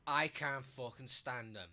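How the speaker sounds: ordinary talk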